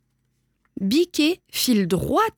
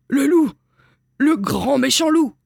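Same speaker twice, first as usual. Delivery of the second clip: high, loud alarmed cry; frightened stammer turned cry; 5 semitones higher, 4 dB louder than usual. A narrator's reading. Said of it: "pâlit, bredouille".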